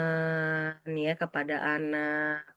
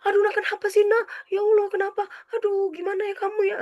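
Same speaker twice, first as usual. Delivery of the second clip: put-on much higher, frantic fussing over the child; panicky mother; faster than usual. Anxious mother fussing over her sick child, high and frantic. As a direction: fret anxiously, pitched way up. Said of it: "kalau misalnya ibunya begini".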